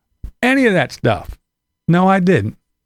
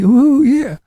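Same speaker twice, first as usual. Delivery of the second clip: high, louder mock gushing "Ooh, yeah"; mock excitement; 9 semitones higher, 4 dB louder than usual. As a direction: ham up a giddy fan's squeal. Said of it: "he got all excited".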